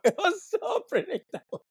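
laughing the words out high. Real, not acted. Podcaster laughing at Chelsea's predictable subs, giggling. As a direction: crack up while repeating it.

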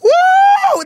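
high, loud whoop of glee; excited woo. Real, not acted. Hearing the ex's wild quote, a loud excited whoop. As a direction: whoop it out in delight.

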